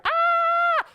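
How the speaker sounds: shrill high shriek, full force; piercing child's scream; terrified scream. Acted. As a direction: scream like a kid who saw a werewolf. Paula screaming while running.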